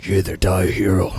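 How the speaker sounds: low put-on cowboy voice. Friend trying the tired voice, comes out cowboy. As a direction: drop it way down for a movie tough guy. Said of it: "I sound like a, like a cowboy".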